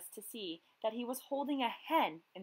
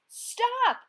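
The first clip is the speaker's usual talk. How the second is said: high, loud furious yell; furious fisherman yelling stop; 10 dB louder than usual. A narrator's reading. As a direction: bellow after the thief. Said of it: "he yelled".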